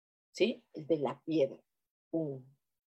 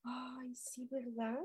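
hushed, moved whisper; touched whisper; 3 semitones higher, 15 dB quieter, slower than usual. Real, not acted. Reacting to a loving viewer comment, softly whispered. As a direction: breathe it out as if moved.

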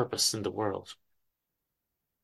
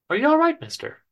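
put-on high, concerned young woman; a caring young woman's high voice; young lady's voice; 14 semitones higher than usual. Young lady checks on crying stranger.

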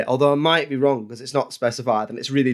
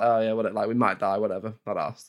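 a lower, much softer and offhand imitation; mock nonchalance; 4 semitones lower, 6 dB quieter than usual. Imitating the heroes not caring about danger, mock-offhand. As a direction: shrug it off like nothing matters. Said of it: "they're just like".

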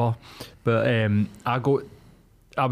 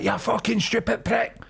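retold angry jeer; 10 semitones higher than usual. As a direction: imitate an irate driver's insult, pitched right up. Retold driver yelling through open window.

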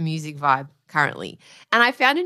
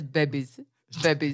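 put-on Arnie accent; 6 semitones lower, 5 dB quieter than usual. Wife imitating how Arnie says babies, lower. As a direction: lower "babies" with a flat Austrian "bebies". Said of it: "I love how he says".

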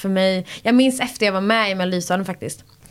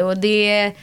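normal talking voice

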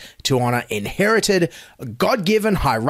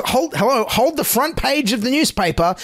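mock urgency; 7 semitones higher than usual. Sarcastic mock breaking news.

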